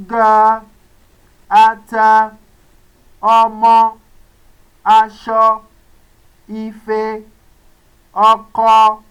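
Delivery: "ata", "ọmọ", "aṣọ" said level and strong; mid tone words. Shown as a demonstration. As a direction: give each word a loud, even mid tone. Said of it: "Let me go over it again".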